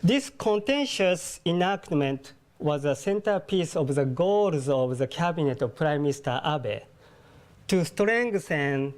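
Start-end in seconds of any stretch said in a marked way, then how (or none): none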